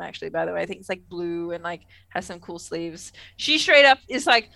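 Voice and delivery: ordinary delivery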